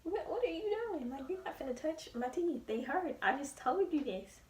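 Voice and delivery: high, sassy pushback; indignant protest. Retold or imitated. Daughter pushing back at mom touching her sore teeth, high indignant.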